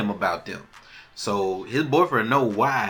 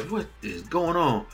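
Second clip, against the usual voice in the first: higher, measured mock indignation; word-by-word bewildered demand; demanding king; 5 semitones higher than usual. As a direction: imitate a ruler demanding answers. King getting up, demanding answers.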